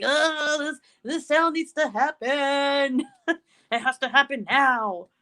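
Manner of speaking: mock urgent plea. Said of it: "you're like".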